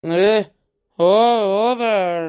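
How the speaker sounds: slow, slurred plea; drunk plea. Acted. Drunk husband begging his wife not to leave, slurred and slow.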